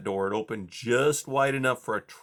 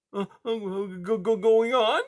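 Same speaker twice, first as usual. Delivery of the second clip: high, frightened mouse stammer; timid mouse tripping over words; 11 semitones higher, 4 dB louder than usual. A narrator's reading. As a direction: stutter nervously in a high little voice. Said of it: "Blinker stammered".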